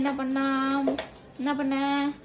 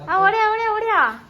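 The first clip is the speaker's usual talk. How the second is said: calling out; 8 semitones higher, 6 dB louder than usual. Real, not acted. Mother calling child to come.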